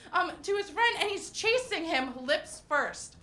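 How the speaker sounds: ordinary delivery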